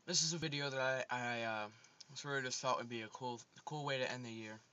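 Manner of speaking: talking around a chocolate; chewing while speaking; mouth full. Real, not acted. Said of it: "Sorry, I had a chocolate in my mouth".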